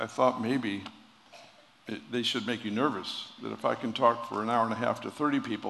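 ordinary talk, nothing marked

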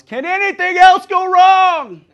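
high, drawn-out exasperated shout; top-of-lungs yell. Acted. Preacher acting someone fed up with troubles, yelling. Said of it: "yelled out loud at the top of their lungs".

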